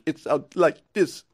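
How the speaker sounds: halting strained voice, speech breaking up; bad impression. Shown as a demonstration. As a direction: imitate a voice spasm, struggling to get words out. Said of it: "that's a bad impression of me trying to talk".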